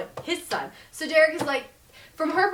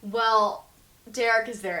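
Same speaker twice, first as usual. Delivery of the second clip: ordinary delivery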